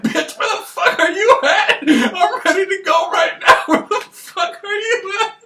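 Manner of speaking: high, loud, laughing holler at the niece; hollering uncle. Retold or imitated. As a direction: yell it like a wild uncle on the porch at 3am. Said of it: "he's like".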